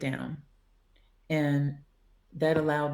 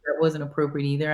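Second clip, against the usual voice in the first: normal talking voice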